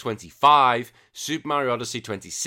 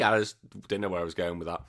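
normal talking voice

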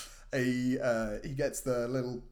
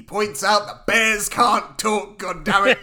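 put-on louder, slow old-man grumbling; grumpy rant; 4 semitones higher, 7 dB louder, slower than usual. Otto grumbling to kids about a bear voice, gruff and louder. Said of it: "He's so grumpy".

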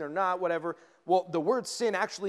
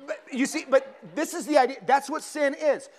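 laughing; 7 semitones higher, 5 dB louder than usual. Real, not acted. Laughs after missing the target.

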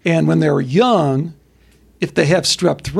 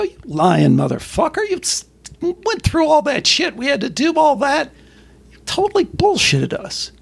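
higher, mock furious accusation; hammed-up outrage, pitched up; angry parents; 10 semitones higher than usual. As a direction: parents accusing officials of lying.

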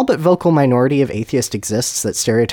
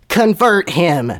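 slow, raised-pitch order; brave command; 6 semitones higher, much slower than usual. Acted. Dumbledore orders his students to convert Voldemort, bravely. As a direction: command it grandly, word by word. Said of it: "Dumbledore commanded bravely".

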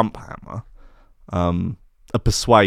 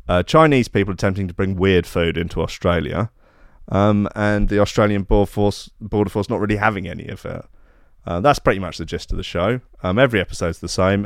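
normal talking voice

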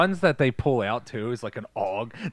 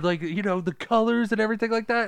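mocking surfer dude; 7 semitones higher than usual. The surfers tease him about his board, in mocking high voice. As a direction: sneer it like a smug surfer. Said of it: "they're like".

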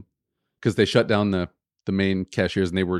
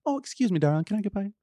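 high, sugary Southern excuse me; sweet Southern lady; 10 semitones higher, 4 dB quieter than usual. Retold or imitated. Imitating a Southern shopper in the aisle, high and sweet. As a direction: lilt it up high like a honeyed Southern belle. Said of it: "Versus in the South, people".

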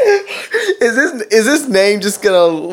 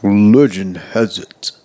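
dramatic narrator; 12 semitones lower, 3 dB quieter, slower than usual. Acted. Reading comment in deep dramatic voice.

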